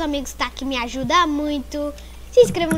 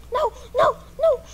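panicking gamer; 9 semitones higher, faster than usual. Real, not acted. Friend dying in game.

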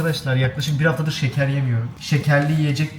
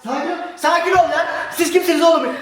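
over-the-top street fight bluster; mock tough shouting; 14 semitones higher than usual. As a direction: play the hothead being calmed. Mock jealous threat.

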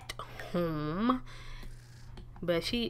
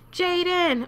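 higher, louder call across the street; 9 semitones higher, 4 dB louder than usual. Real, not acted. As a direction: call his name out. Calling to a sim.

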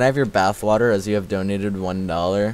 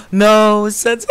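high, loud yelp of dismay; dismayed cry; 12 semitones higher, 10 dB louder, much slower than usual. Real, not acted. Streamer reacting to a mishap in the game, dismayed.

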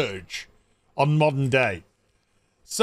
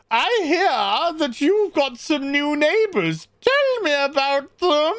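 higher, slower put-on posh voice; a lightly voiced snooty friend; 9 semitones higher than usual. Tofty friend asks Karen about her neighbors, lightly posh voice. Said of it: "tofty friend says".

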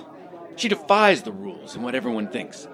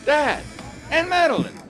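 excited call. Acted. Excited greeting of parents.

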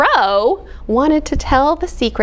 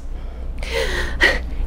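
sharp breathless gasp; Cat amazed by the secret; soft gasp; 7 dB quieter than usual. Acted. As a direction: gasp in surprise.

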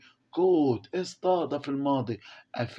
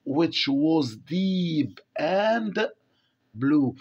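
slow reading aloud; 3 semitones higher, much slower than usual. Shown as a demonstration. Teacher reading the text to the class, very slowly. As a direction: read each English word very slowly for the class.

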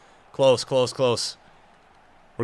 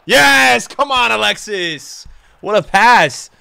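loud joyful cheer, slow "Come on"; goal celebration; 3 semitones higher, 4 dB louder, slower than usual. Real, not acted. Gamer cheering his striker Alexis's goal, loud cheer. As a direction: cheer the pass like a fan.

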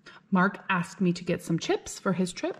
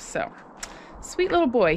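usual speaking voice

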